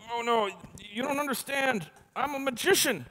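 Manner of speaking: high-pitched nervous pleading; panicked plea. Retold or imitated. Driver pleading with the constable, high and panicked. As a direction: plead your case up high and fast.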